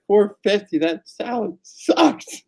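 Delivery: high, slow, drawn-out indignation; hammed-up complaint about the bill, slow and high; mock outrage. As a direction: stretch out the disbelief.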